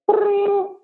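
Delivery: high imitated phone ring. Author mimicking a ringing phone for the story. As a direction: ring like a telephone.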